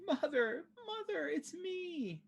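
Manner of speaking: tiny, very high pleading wail; crying daughter. Acted. Withered Sharwin cries to her mother, soft high acted wail. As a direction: plead softly, very high, drawing out the words. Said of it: "Sharwin is crying".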